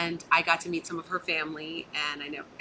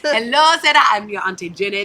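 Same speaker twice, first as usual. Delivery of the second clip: excited auntie; 3 semitones higher, 10 dB louder than usual. Retold or imitated. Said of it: "all these African family members are calling".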